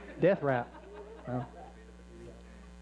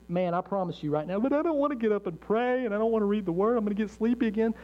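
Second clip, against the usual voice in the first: mock lazy excuse; 5 semitones higher than usual. Congregant's excuse voiced mockingly.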